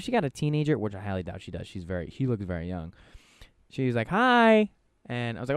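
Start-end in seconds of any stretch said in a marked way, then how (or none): 4.1-4.7 high, loud sing-song hi; imitating the manager's high bright hello to his son; sweet greeting to a child.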